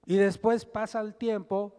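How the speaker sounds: ordinary delivery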